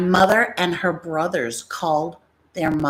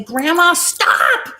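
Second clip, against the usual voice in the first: yelling at grandma; 11 semitones higher, 4 dB louder, much slower than usual. Retold or imitated. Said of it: "we'd finally say".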